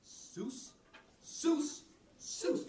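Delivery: "soos" climbing in pitch with each repeat; Irish word soos. Shown as a demonstration. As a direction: say "soos" as steps going up. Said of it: "here's the Irish for up".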